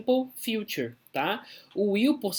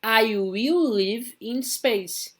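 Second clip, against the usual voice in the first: slow, careful model reading; model sentence; 3 semitones higher, much slower than usual. Shown as a demonstration. Teacher reads the example on the slide, slow and careful. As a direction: spell it out slowly for the class. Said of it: "Olha só aqui".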